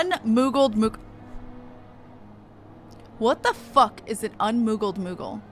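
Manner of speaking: ordinary talk, nothing marked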